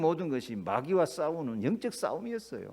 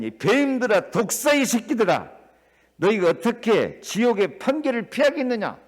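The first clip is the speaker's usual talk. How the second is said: higher, louder scathing rebuke; fiery denunciation; 6 semitones higher, 6 dB louder than usual. Acted. Jesus denouncing scribes and Pharisees, fiery and loud. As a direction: thunder at the hypocrites.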